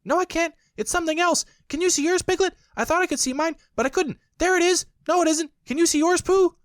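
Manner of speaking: put-on very high, loud squeaky child voice; high squeaky joey voice; little Roo chatter. A narrator's reading. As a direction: babble like an eager baby kangaroo.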